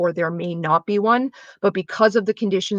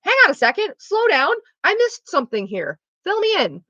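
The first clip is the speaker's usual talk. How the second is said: high, bright, lightly louder check-in; high sing-song demo of the better way to respond; gentle check-in; 10 semitones higher, 4 dB louder than usual. Shown as a demonstration. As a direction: ask to be filled in, lifted way up in pitch. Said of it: "It's like".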